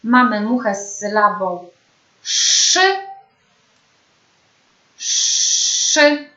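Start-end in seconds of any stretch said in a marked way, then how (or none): 2.2-3.0 "szy" with a long hushing sz before the vowel; drawn-out SZ. Shown as a demonstration. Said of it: "Sylaby poćwiczone". 5.0-6.3 "szy" with a long hushing sz before the vowel; drawn-out SZ. Shown as a demonstration. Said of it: "Sylaby poćwiczone".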